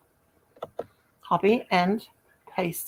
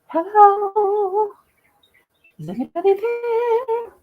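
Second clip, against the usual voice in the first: high calling out; 11 semitones higher, slower than usual. Pretend. Calling into empty stream room.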